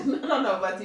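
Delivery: giggly high denial; laughing protest. Real, not acted. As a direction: deny it through giggles.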